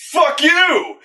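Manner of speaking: hammed-up furious shout; mock yell. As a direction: fling it back like an angry wolfman. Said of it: "is just like".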